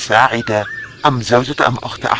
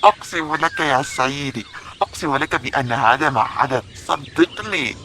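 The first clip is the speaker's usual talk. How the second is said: pleading; 6 semitones higher, 5 dB quieter than usual. Acted. Accused cook begs inside tent.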